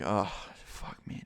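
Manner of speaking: very soft, slow, voiceless sigh of despair; exasperated groan. Retold or imitated. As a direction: breathe it out, utterly fed up. Fed up with the bully's insults.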